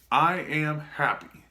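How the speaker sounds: slow, deliberate affirmation; said slowly with conviction. Shown as a demonstration. Leading viewers in the affirmation, slow and deliberate. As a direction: say it slowly like you mean it. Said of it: "say it with me".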